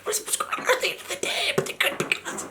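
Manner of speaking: urgent backstage whisper; whispering stagehand asking the band to wrap up. Retold or imitated. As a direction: hiss the news quietly and quickly. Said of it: "comes up to me and whispers".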